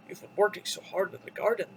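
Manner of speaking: low storybook monster voice; Beast. A narrator's reading. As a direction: drop low to explain as the Beast. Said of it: "the Beast explained".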